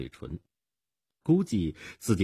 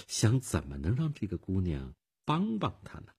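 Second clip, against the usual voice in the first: ordinary delivery, nothing marked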